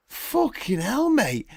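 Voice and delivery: high, slow, drawn-out disbelief; acted stunned protest; exasperated shock. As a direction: stretch it out as an appalled barman. Barman acted, shocked at brawl.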